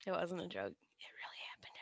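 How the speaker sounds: voiceless, conspiratorial whisper; very soft stage whisper; whispered aside. Pretend. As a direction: whisper it behind someone's back. Secretly contradicting the denial.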